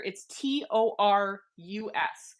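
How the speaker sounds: T O R U S, each letter said slowly and separately; spelled out letter by letter. Shown as a demonstration. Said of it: "go research this after".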